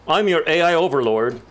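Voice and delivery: mock a fake AI overlord voice. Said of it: "his voice is a bad accent".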